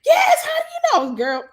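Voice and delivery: high, loud excited scream. Retold or imitated. Sister on the phone, screaming in amazement. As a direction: shriek it in disbelief. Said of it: "she was screaming on the phone".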